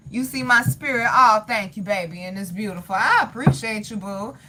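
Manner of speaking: ordinary talk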